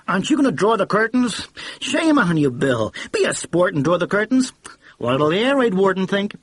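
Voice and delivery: loud careless cheer meant to be overheard; loud hearty voice. A narrator's reading. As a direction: play it loud for the eavesdropper. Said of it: "So Tilly spoke in a loud, hearty, careless voice".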